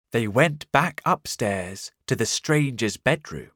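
ordinary delivery

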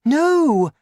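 very high, loud mother's cry; high-pitched, urgent shout; alarmed cry. A narrator's reading. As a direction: cry out to stop her. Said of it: "Missus Cranky cried".

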